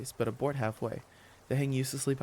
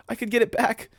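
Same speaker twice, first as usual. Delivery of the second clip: raised, high and urgent; urgent exclamation; 9 semitones higher, 7 dB louder than usual. Acted. Jamie dashes off for the bag, urgent exclamation. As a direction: call it out with sudden resolve.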